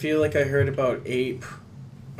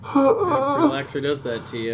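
mock crying; slower than usual. Mock grief over the film.

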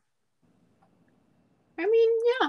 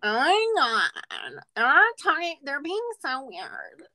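put-on wholesome Midwest accent; 5 semitones higher than usual. Gossiping that they're being so weird, wholesome Midwest accent. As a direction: play a scandalized Midwesterner, vowels stretched. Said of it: "your wholesome Midwest accent".

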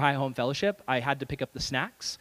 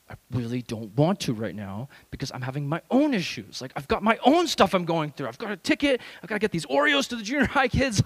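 protesting; 2 semitones higher than usual. Retold or imitated. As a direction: plead your own troubles, pitch rising. Retold whiny protest to God.

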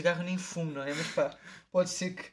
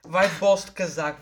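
usual speaking voice

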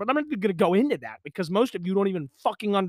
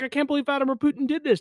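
mocking quote; 7 semitones higher, faster than usual. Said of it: "Everyone's like".

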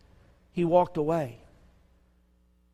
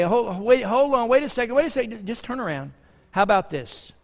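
mock pleading bargain; 6 semitones higher, 5 dB louder than usual. What Jesus didn't say.

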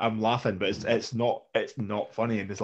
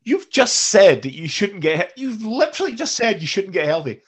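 incredulous retort; 8 semitones higher, 6 dB louder than usual. Retold or imitated. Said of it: "You're like".

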